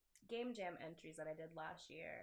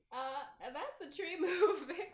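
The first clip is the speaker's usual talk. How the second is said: laughing out loud mid-line, higher and louder; real giggling fit over a wobbly tree; 9 semitones higher, 8 dB louder than usual. Real, not acted. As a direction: crack up at your own clumsy tree animation. Laughs at own crude animation.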